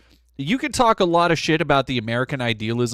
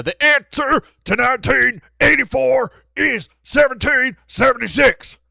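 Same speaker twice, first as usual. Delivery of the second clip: louder, higher hammed-up patriot shout; mock patriot; 10 semitones higher, 9 dB louder than usual. Streamer answering a chatter, in a hammed-up patriot shout. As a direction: bellow it like a flag-waving zealot.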